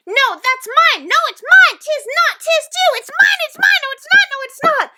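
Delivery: very high, rapid-fire yelling; escalating squabble. A narrator's reading. Twins at the height of their quarrel, screeching.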